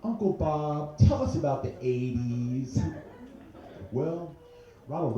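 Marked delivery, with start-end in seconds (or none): 0.0-2.8 imitating kids asking. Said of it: "My nieces and nephews are like".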